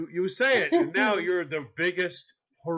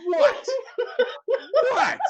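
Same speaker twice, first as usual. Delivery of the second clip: mock wife; 5 dB louder than usual. Mimicking wife's sleepy question.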